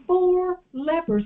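high, slow, drawn-out Four lepers; preacher calling out Four lepers slowly; slow emphatic call. Shown as a demonstration. Preacher leads the congregation, slow and high. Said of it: "everybody do this".

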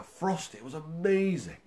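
normal talking voice

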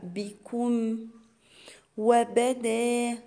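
drawn-out tajweed chant, each syllable held; slow Quran recitation. Shown as a demonstration. Teacher recites the verse to students as a slow chant. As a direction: recite it slowly for the students, holding the long vowels. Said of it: "كلها حروف مفتوحة".

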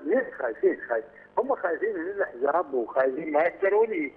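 normal talking voice

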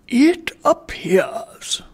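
high, drawn-out pompous opening; a lofty, self-satisfied gentleman. Acted. Sir Joseph speaks.

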